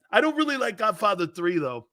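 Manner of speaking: ordinary talk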